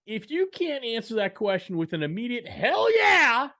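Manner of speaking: loud, high-pitched carnival barker hollering. Acted. Host amping up the podcast for distributors, carnival barker voice. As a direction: belt it out high like a barker drumming up a crowd. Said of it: "some weird Carnival Barker voice".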